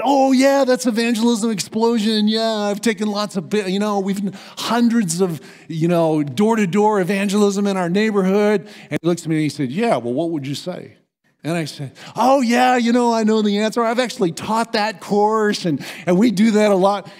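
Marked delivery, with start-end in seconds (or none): 0.0-8.7 high-pitched, gushing know-it-all; mock-eager bragging to dodge the question; eager self-mockery. His eager, dodging younger self. 12.2-17.1 high-pitched, gushing know-it-all; mock-eager bragging to dodge the question; eager self-mockery. His eager, dodging younger self.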